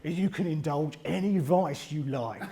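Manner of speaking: slow, badly faked Australian accent; stretched-out mock Australian; terrible Australian accent. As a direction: play a brusque Australian salesman, unconvincingly. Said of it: "it's a terrible accent".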